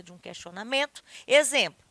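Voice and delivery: ordinary talk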